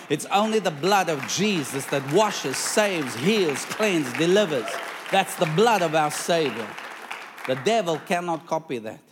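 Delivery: loud, building preaching; fervent preaching. Acted. Preacher proclaiming the blood of Jesus to the church, loud and fervent. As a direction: drive each word home to the congregation.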